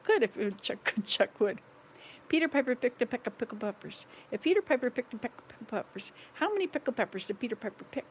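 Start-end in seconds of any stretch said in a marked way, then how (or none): 2.3-8.1 rapid Peter Piper, rushed; fast tongue twister. Shown as a demonstration. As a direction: race through it from memory. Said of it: "I can probably say that fast".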